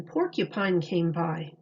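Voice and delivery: plain reading voice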